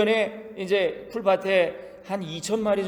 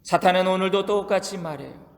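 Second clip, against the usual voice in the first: normal talking voice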